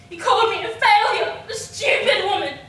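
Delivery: loud, indignant retelling; bitter outburst. Acted. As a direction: throw the insults out with force. Said revolting words.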